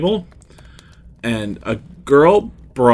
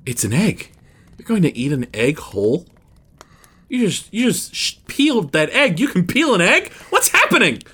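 put-on childlike astonishment; 7 semitones higher than usual. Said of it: "we were kinda all like".

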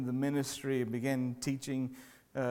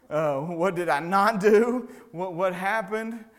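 higher, slower alarmed questions; retold panic; 5 semitones higher, 8 dB louder, slower than usual. Chaplain recalling his fear when the doctor called, alarmed. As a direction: replay the moment of dread, stretched out.

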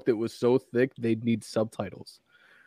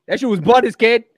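put-on higher-pitched Boston-area slang accent; New England accent; 9 semitones higher, 4 dB louder than usual. Retelling how skate-park kids talked, mock New England accent. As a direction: throw it out in a Massachusetts accent. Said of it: "Massachusetts and Rhode Island, that same accent".

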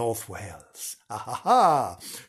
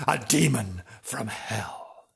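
dramatic demon; 3 semitones lower, slower than usual. Acted.

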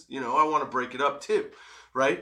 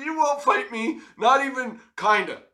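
higher, mocking disbelief; taunting; 8 semitones higher than usual. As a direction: needle him, half laughing. Retold taunting of challenger.

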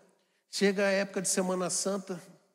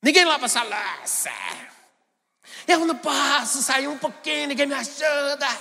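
loud mock yell at the family; furious mother; 6 semitones higher, 7 dB louder than usual. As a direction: shout it like an angry mom who came home to dirty dishes. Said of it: "você já chega como?". (mãe brava gritando com a família por causa da louça)

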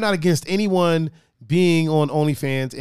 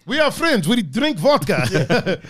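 high, hammed-up Russian accent, slowing right down; drawn-out cartoon Russian; horrible Russian accent; 3 semitones higher, much slower than usual. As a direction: do a bad Russian drinking buddy. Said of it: "not very good at a Russian accent".

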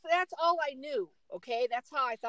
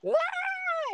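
mock toddler wail. Imitating toddler's constant crying.

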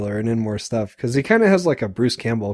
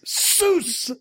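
weird kick noise; 3 dB quieter than usual. Retold or imitated. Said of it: "I wrote it down as".

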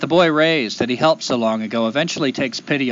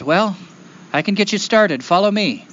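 helpful woodchuck; 7 semitones higher than usual. Acted. Woodchuck offers to guide the boy.

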